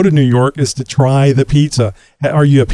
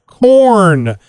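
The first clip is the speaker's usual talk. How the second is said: a cheery game-show welcome, slightly higher; announcer intro; 4 semitones higher than usual. Acted. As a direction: open the segment like a radio announcer. Show host opens interview segment.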